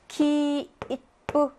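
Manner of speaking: 키, 이쁘 split into separate beats. Shown as a demonstration. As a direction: give each beat of 키, 이쁘 its own time. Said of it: "이렇게 읽어줘야 돼".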